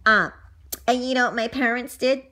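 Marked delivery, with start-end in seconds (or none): none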